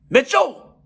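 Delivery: loud high call across the ring; called-out name. Retold or imitated. Calling out a name.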